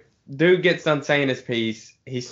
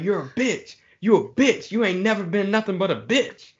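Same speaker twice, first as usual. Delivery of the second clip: higher, imitating an angry coworker yelling in his face; retold finger-pointing rant, pitched up; mock angry yell; 8 semitones higher than usual.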